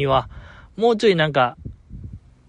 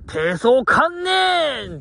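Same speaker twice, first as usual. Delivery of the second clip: slow drawn-out mock call, higher and far-carrying; retold shout; 7 semitones higher, much slower than usual. Shouting toward the eastern sky, stretched long and slow. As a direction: stretch each syllable like a call across the sky. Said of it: "東の空に向かって僕叫んでます".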